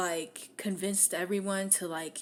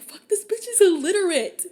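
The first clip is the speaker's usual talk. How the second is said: loud, high exasperated cry; a much higher, louder mock shout; mock outraged yell; 10 semitones higher, 10 dB louder than usual. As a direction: yell it like you can't believe it. Retelling shocked reaction, shouted.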